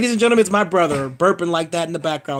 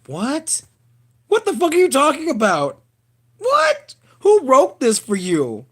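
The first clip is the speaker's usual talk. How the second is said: rising, higher and louder in baffled outrage; incredulous disbelief; 8 semitones higher, 4 dB louder than usual. Retold or imitated. Vlogger reacting to a dumb trailer joke, loud incredulous high what. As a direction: sputter what like you can't believe the line. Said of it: "And I'm like".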